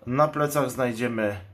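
normal talking voice

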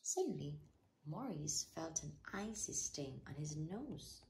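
usual speaking voice

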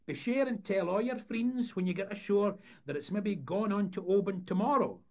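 high, loud fairground patter; showman's announcement. Acted. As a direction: proclaim the attraction to a paying crowd. Voices showman addressing the crowd.

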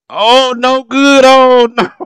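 high, loud, half laughing at the miss; laughing dismay. Real, not acted. Fan reacts to Jordan missing, high laughing dismay. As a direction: groan and laugh as the shot misses.

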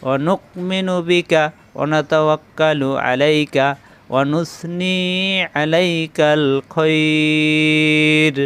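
slow melodic chanting of the dua; chanted Arabic prayer, every vowel held; Quran-style recitation. Shown as a demonstration. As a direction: recite in a measured, sung tajweed cadence. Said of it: "আমরা আরেকবার পড়ি".

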